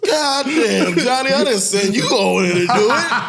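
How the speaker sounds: high, loud, laughing disbelief; retold shocked yell, laughing; laughing exclamation. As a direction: shout it through laughter.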